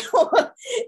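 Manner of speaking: high, loud burst of laughter. Real, not acted. Joking about [name]'s motivation, loud laugh. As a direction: laugh at your own joke. Laughing at her own joke.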